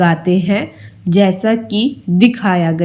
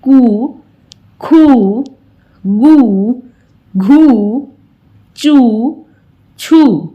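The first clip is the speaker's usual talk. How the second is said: कू drawn out and pushed louder; long oo stressed; 2 semitones higher, 4 dB louder, much slower than usual. Shown as a demonstration. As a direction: lean on each कू with force. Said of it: "फिर से जोर देकर बोलेंगे". (बड़े ऊ पर ज़ोर देकर बोलना)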